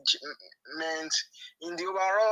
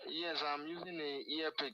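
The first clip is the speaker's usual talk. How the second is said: muffled earpiece; 13 dB quieter than usual. Real, not acted. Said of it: "it's muffled".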